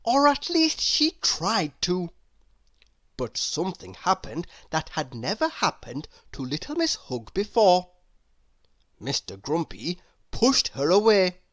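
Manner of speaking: plain reading voice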